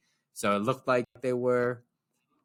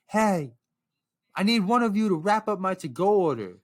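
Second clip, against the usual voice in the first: imitated yell, higher pitched; retold yell; 6 semitones higher, 4 dB louder than usual. Lady calls to staff over a customer, imitated yelling. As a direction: call out over someone's head to staff. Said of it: "lady yells over me".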